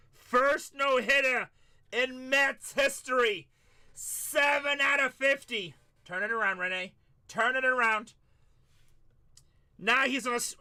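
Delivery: Gary Cohen announcer call. Retold or imitated. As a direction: call it like the Mets announcer at the big moment. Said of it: "'cause I get to talk like that".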